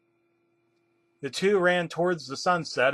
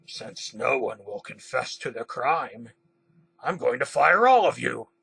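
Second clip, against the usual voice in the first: mildly villainous, deliberate announcement; evil. A narrator's reading. Boss Green threatens to fire all the staff, evilly. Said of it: "Green said evilly".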